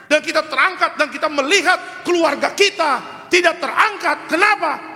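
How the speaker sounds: high, urgent sermon climax; rising pulpit fervor; fiery preaching. Acted. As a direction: preach it hard to the pews. Pastor preaching at full force.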